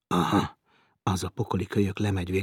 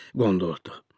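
ordinary delivery, nothing marked

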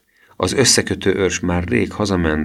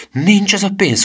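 much higher, louder, overeager; eager promise; 5 dB louder than usual. Acted. Boy assuring the thief with exaggerated zeal. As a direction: gush the promise like a keen servant.

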